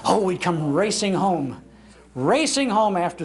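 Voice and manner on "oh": ordinary delivery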